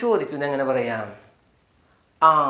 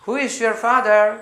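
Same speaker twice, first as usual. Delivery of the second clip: higher, louder and slow; emphatic model; 8 semitones higher, 6 dB louder, much slower than usual. Shown as a demonstration. Teacher repeats the question boldly for the class. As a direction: call it out clearly. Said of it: "അപ്പം mister പേര് പറയുക".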